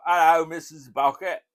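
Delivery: high, loud Cockney greeting; Cockney impression. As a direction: call out like old George. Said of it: "he'd go".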